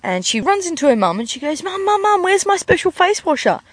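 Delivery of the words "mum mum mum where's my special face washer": put-on high-pitched kid calling for mum; higher, insistent daughter voice; pleading daughter. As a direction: whine it urgently like a child. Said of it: "her daughter comes in the door".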